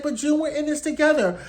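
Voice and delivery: high, sugary pleading; mock pleading Serena. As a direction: whine it sweetly like a fake friend. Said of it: "she's like".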